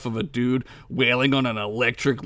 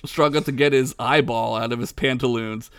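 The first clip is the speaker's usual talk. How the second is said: usual speaking voice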